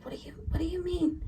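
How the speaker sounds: breathless, bewildered whisper; barely voiced, puzzled question. Confused reply to the spirit.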